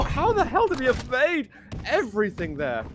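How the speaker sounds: fast, rising hype call; esports caster. Acted. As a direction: speed up with the action. Live play-by-play.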